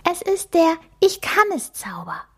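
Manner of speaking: high and bouncy mouse voice; hopping with joy; cheerful call. Acted. The mouse cheerfully names her spell to Emma. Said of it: "ruft Lieselotte fröhlich".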